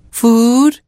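very high, drawn-out call; giraffes shouting. A narrator's reading. Giraffes to a deaf old baboon, high mock shout. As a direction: call it high to someone hard of hearing. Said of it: "they shouted".

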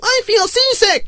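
very high squeaky whine; seasick crewman. Acted. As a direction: pipe up queasily in a high voice.

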